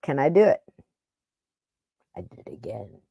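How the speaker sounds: usual speaking voice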